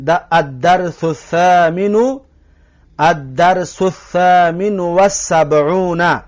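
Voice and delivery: slow, careful recitation of the Arabic title; slow Arabic reading. Shown as a demonstration. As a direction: read the Arabic title out slowly.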